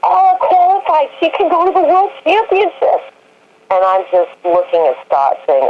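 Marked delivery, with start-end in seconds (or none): 0.0-3.1 much higher, breathless squeal of news; excited mom. Retold or imitated. Mom running to the trailer with a ribbon, squealing excitedly. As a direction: gush it out like big news. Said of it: "And Julia says".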